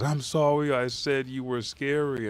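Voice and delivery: high, mock-tearful apology; imitated crying apology. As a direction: act out a child crying sorry. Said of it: "as he cries".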